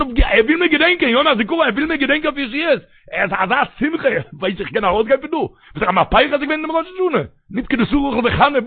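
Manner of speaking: very high, urgent preaching cry; impassioned preaching. Acted. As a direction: cry it out at the top of your pitch, pleading with the crowd. Preacher rises to an impassioned outcry. (מיט פֿײַער, אויף אַ הויך קול)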